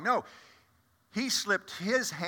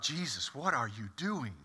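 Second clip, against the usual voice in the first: much softer, hushed disbelief; aghast inner thought; 2 semitones lower, 8 dB quieter than usual. Retold or imitated. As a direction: mutter it under your breath, horrified.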